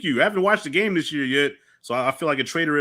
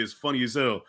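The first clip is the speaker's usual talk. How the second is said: ordinary talk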